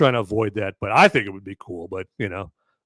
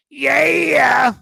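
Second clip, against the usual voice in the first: high, loud drawn-out whoop; imagined John Wick yell; 7 dB louder than usual. Acted. Playing John Wick suddenly erupting mid-scene, high shouted whoop. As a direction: burst out with a triumphant yell. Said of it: "all of a sudden John Wick".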